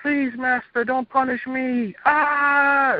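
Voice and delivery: high, slow begging wail of a demon; pleading demon. Retold or imitated. Demon begging its Master not to punish it, high pleading wail. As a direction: beg your master for mercy, way up high. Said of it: "demons would come out hollering".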